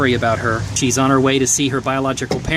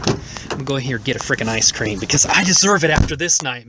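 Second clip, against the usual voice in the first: ordinary talk, nothing marked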